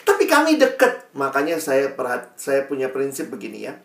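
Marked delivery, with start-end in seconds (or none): 0.1-1.1 much higher mock-whiny protest; imitated tearful protest. Upset teen insists to mentor, mock-tearful. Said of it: "Ya kenapa kamu nangis-nangis?".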